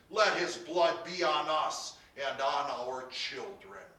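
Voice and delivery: loud, slow proclamation; crowd declaration. A narrator's reading. All the people answer Pilate, declaring loudly. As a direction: declare it forcefully, drawn out.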